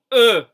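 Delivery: "E" said with rounded lips as if for O; French E sound. Shown as a demonstration. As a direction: shape the mouth for O but say "E". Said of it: "mirar O y decir una E".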